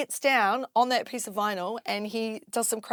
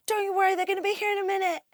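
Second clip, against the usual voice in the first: high, fast acted pep talk; hurried reassurance; 12 semitones higher, 3 dB louder, much faster than usual. Promoter promises DJ a crowd, hurried reassurance. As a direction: rush out the reassurance on a raised pitch. Retells reassuring the nervous DJ again.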